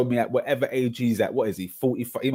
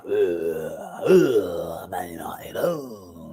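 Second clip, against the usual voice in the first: disgusted groan; 5 semitones lower, much slower than usual. Real, not acted. Rival fan disgust at United.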